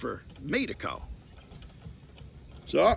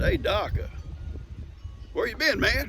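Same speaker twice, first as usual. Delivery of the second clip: casual phone call; 9 semitones higher than usual. Retold or imitated. Voicing himself on the call.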